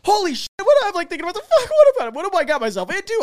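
loud, very high-pitched retold freak-out; inner panic. Private thinking to himself while cornered, panicking. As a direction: let panicked thoughts tumble out, hammed up.

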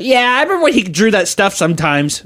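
high, dismissive shrug; offhand mock voice. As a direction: toss it off carelessly. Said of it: "everyone else is just like".

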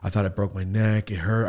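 ordinary talk

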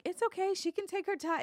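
high, polite imitation of agents reassuring; retold reassurance. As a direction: imitate someone soothing in a high voice. Retells agents reassuring about nervous child.

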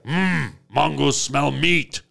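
lightly voiced dim caveman, broken grammar; a hint of a brutish caveman; caveman talk. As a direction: Mongo grunting like a caveman. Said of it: "he still talks like this".

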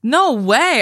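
no way, can't believe it; surprised. Real, not acted. Co-host hearing a guess confirmed, surprised. Learning Butler's hometown.

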